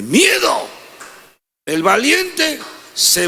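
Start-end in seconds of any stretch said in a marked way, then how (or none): 0.0-0.7 high shout on "de miedo"; sudden shout. Acted. Preacher describing Saul's terror, sudden shout. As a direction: burst out with the word.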